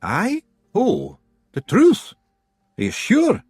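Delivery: high, slow Scots voice, nervous; wary of the truth. A narrator's reading. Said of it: "Rob ventured rather nervously".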